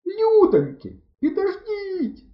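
very high desperate screech; squealing plea; shrieking. A narrator's reading. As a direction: shriek after them to wait, stretching the last word. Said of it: "проверещали ми й замовкли".

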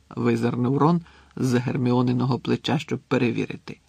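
ordinary delivery

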